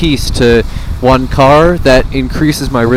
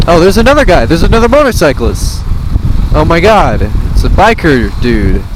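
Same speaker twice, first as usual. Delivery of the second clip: excited; 7 semitones higher, 3 dB louder than usual. Real, not acted. Spots another motorcyclist.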